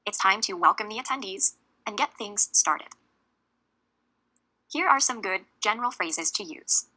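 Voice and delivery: ordinary delivery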